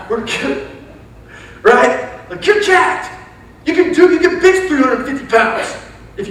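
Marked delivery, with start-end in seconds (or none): 2.5-6.3 high, loud hype-up; crazy coach hollering. Retold or imitated. Coach telling players how strong they are, hollering. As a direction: imitate a coach pumping up the team. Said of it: "He would just, like, freak us out".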